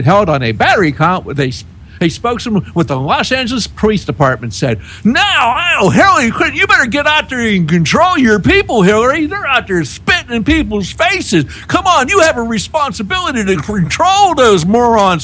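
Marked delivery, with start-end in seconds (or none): 5.0-15.2 high, raised mock scolding of Hillary. Host mockingly tells Hillary to control her people, high scolding. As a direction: wag a finger at her in a high voice.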